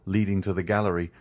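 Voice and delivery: ordinary delivery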